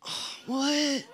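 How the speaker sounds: soft, high-pitched fake confusion; feigned innocence. Boys pretending to be asleep answer the watchman, mock innocent. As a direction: play dumb in a small, high voice.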